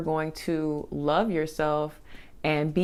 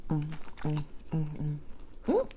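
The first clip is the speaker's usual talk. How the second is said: savoring hums; 13 dB quieter than usual. Real, not acted. Eating and savoring.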